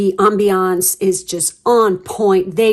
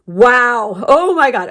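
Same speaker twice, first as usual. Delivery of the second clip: high, loud "wow" of real amazement; excited gasp; 4 semitones higher, 6 dB louder, slower than usual. Real, not acted. Reader amazed at the card, gasping in delight. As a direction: let it burst out at the card. Reacting to the card she sees.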